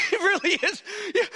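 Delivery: usual speaking voice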